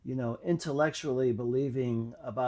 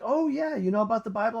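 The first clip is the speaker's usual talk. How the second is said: much higher and louder, excited curiosity; eager girl's question; 9 semitones higher, 6 dB louder, faster than usual. Retold or imitated. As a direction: voice the girl lighting up. Girl surprised he knows Bible.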